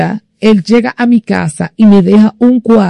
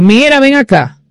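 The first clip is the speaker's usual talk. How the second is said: higher-pitched summons, not shouted; retold calling over; 7 semitones higher than usual. Calling the painting seller from near a house, retold summons. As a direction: call him over with a raised pitch.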